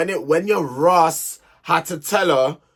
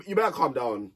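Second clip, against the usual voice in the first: ordinary talk, nothing marked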